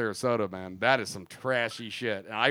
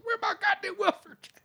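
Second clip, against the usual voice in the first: gruff impatient scolding. Retold or imitated. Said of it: "storming in".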